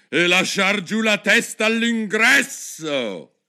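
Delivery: high, slow, loud queen's order; imperious shout. A narrator's reading. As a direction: call out a royal command, drawn out. (ordine urlato da regina, lento e acuto)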